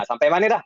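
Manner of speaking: "sampai" said "sampe", final AI as E; Perak dialect ending. Shown as a demonstration. Student gives a Perak example, "sampai" ending in E. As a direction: ask it Perak-style with "sampe". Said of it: "dia akan jadi sampai".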